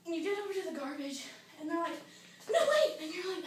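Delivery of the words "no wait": high, loud cry to stop; panicked shout. Acted. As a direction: yell it like an urgent alarm. Acted panic at the garbage.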